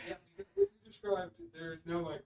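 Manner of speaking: distant, off-mic voice; calling from another room. Real, not acted. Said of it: "You're in the wrong room for the microphones".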